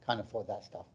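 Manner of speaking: ordinary talk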